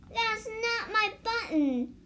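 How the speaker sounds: a hint of a wail of dismay. A narrator's reading. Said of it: "wailed Toad".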